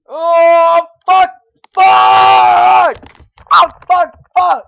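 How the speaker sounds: loud raging yells of fuck; real gamer rage; furious shouting. Real, not acted. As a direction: scream it out in real frustration. Raging at failed jump.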